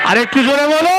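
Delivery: high, rising crowd call; rousing call-out. Real, not acted. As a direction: call out for a bigger response. Urges crowd to answer louder.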